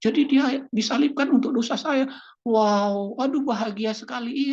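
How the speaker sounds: newly believing person rejoicing over forgiven sins, high and loud; joyful new believer. Retold or imitated. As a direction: burst out in happy discovery.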